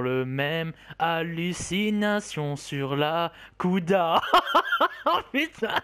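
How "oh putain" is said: laughing. Real, not acted. Laughing at his own hallucination.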